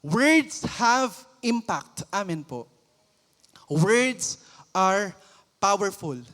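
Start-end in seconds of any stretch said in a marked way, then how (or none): 0.0-2.0 each word slow and weighty; slow emphasis. Real, not acted. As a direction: drive the words home slowly. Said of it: "emphatic sermon point".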